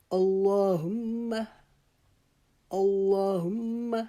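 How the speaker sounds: slow, drawn-out Quranic chant of اللہم; a melodic, prayerful recitation; tajweed recitation. Shown as a demonstration. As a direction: recite اللہم slowly, lingering on the held م. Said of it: "ما کو جلدی سے پڑھ لیں گے".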